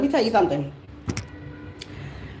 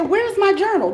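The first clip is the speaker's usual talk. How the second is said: much higher, demanding where it went; alarmed question; 11 semitones higher than usual. Retold or imitated. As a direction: call out for the missing journal. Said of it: "And then I said".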